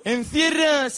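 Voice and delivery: very high, loud comic cry; scoffing exclamation. Acted. Comic dismissing bull runs as old hat, high exclamation. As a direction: cry it out in mock scorn.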